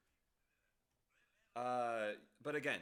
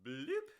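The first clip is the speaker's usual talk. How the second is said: playful bloop; 6 dB louder than usual. Real, not acted. Song ends, playful sound.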